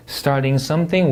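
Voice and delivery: "Starting something" slow, lifted at the end; rising tune. Shown as a demonstration. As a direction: let "something" climb in pitch. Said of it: "올려 읽고". ("something"을 올려 읽기)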